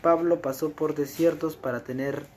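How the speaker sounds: loud, ringing sermon voice; fervent preaching. Acted. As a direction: preach it out to the congregation. Preaching rises to full force.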